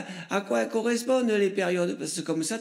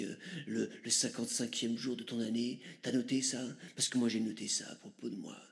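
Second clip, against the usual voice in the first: low, hushed mystic guru; a solemn shaman murmuring his teachings, lower and softer; deep breathy new-age mystic, lower and softer; Chaman Chorizo; 5 semitones lower, 6 dB quieter than usual. Acted. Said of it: "je vais te laisser avec, euh, Chaman Chorizo".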